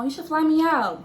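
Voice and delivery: high-pitched teasing hint; half-kidding request; joking, playful. Retold or imitated. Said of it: "Joking, playing".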